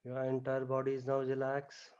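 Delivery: soft, hushed meditation voice; guided relaxation. Acted. Yoga teacher guiding students lying on the floor, soft hushed voice. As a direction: ease the class down into stillness, softly.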